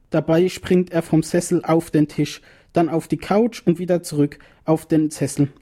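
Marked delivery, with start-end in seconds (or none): none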